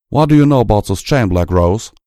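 loud, fast suspicious challenge; sharp demand. A narrator's reading. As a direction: snap the question out.

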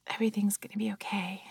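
hushed reassuring whisper; calm them down to sleep; soothing whisper. Pretend. Lulling a baby to sleep.

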